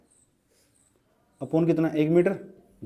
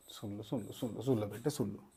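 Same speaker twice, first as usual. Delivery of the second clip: hushed calling; 8 semitones lower, 17 dB quieter than usual. Real, not acted. Teacher quietly calling students to attend.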